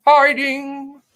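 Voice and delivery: hiding stretched high and loud; dramatic emphasis. Real, not acted. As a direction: land the word like a reveal. Dramatic reading of news.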